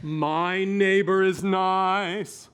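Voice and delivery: slow, intoned on one tone; long held vowels; sustained voice exercise. Shown as a demonstration. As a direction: stretch it like a vocal warm-up. Coach and pupil intone voice drill.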